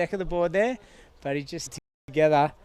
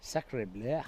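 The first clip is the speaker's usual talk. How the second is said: softer mock French exclamation; French accent; 4 semitones lower, 9 dB quieter than usual. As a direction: toss off a lightly hammed Sacre bleu. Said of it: "cultural bloody stereotypes".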